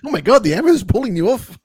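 a self-confessed bad Kiwi accent, laughing through it; terrible Kiwi accent. Podcaster quoting a Thor movie line in a bad Kiwi accent, laughing. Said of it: "that's my terrible Kiwi accent".

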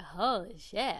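offhand imitation, barely reacting; nonchalant. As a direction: say it casually, as if unimpressed. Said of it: "It's, like, very, like, nonchalant".

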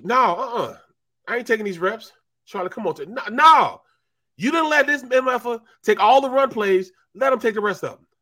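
high, loud, indignant; mock defiant refusal. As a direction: refuse the coach flatly. Charlie refusing reps.

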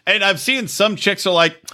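normal talking voice

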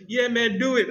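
high, cocky voice; mock eager kid. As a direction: play the kid daring him. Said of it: "he's like".

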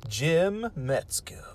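breathy hushed name; whisper. Acted. Name whispered after big intro.

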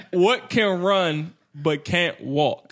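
slow, deliberate riddle reading. Shown as a demonstration. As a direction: stretch each word out for them. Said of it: "Read slow, brother".

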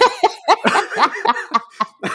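high burst of laughter. Real, not acted. Laughing at own bit.

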